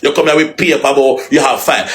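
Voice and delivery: ordinary delivery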